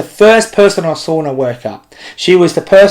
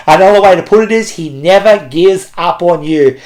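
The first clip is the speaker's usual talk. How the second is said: ordinary talk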